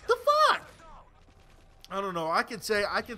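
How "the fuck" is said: high, loud laughing outburst of disbelief; stunned disbelief. Real, not acted. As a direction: yelp it out in shocked disbelief. Reacting to a game death.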